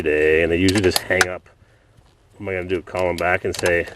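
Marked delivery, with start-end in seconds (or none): none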